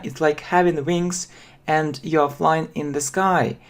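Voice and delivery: ordinary delivery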